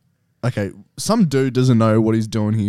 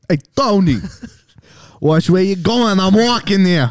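higher, louder New York cabbie; slowed, hammed-up New York yell; playing a New York guy yelling at traffic; New York accent; 7 semitones higher, 4 dB louder than usual. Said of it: "do this in a fucking accent".

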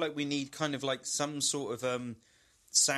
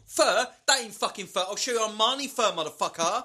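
indignant chav comeback, high and loud; hammed-up street boasting; chav trash talk; 10 semitones higher, 9 dB louder than usual. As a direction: fire back loudly like an offended chav. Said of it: "trash talking a brown bear".

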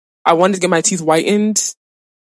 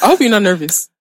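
ordinary talk, nothing marked

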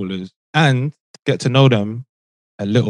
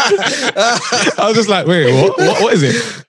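laughing; 10 semitones higher, slower than usual. Real, not acted. Laughing at himself.

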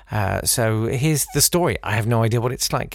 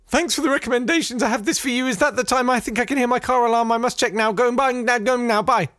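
much higher, fast flustered gabble; panicked nervous babble; 12 semitones higher than usual. Retold or imitated. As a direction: blurt it out and flee in a panic. Nervous suitor's panicked exit.

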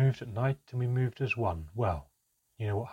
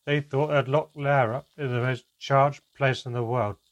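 loud, slurred and careless; tipsy, loud and unengaged; drunken slur; 3 semitones higher, 7 dB louder than usual. A narrator's reading. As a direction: slur it like she's had too much wine. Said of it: "replaced by an unengaged slur".

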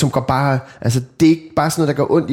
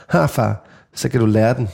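lower, deadpan little jab; cold put-down; 4 semitones lower than usual. Retold or imitated. Daughter coolly telling dad he had it coming, deadpan. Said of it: "kigger Ellie op på mig helt koldt".

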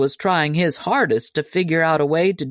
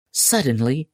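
plain reading voice

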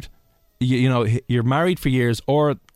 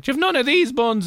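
put-on much higher old lady voice; imitating elderly lady; 13 semitones higher than usual. Old lady asking the lad for buns, high pitched. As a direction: ask it high like a fussy old woman. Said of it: "She's like".

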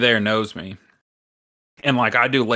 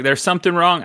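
higher-pitched, shaky attempt at a Jamaican accent; struggling Jamaican accent; 7 semitones higher, 3 dB louder than usual. Manager warning a guest, struggling Jamaican accent. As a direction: try and fail at a Jamaican accent. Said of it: "I'm struggling with it".

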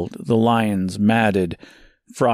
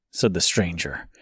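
much softer and lower; low soft aside; 5 semitones lower, 6 dB quieter than usual. A narrator's reading. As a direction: drop the tag to a low aside. Narration tag, dropped low.

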